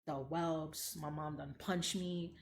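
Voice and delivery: ordinary talk, nothing marked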